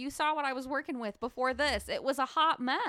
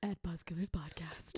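whisper; 8 semitones lower, 10 dB quieter than usual. Acted. Echoing handle as an aside.

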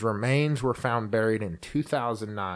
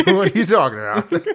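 laughing; 9 semitones higher, 8 dB louder, faster than usual. Real, not acted. Laughing at own geography slip.

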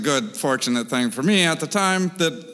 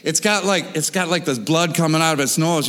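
ordinary delivery

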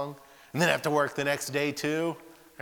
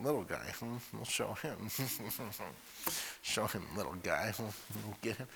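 softer, sly plotting undertone; scheming mutter; 10 dB quieter than usual. Retold or imitated. Older brothers plotting against the little guy, sly and hushed. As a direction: plot revenge under your breath.

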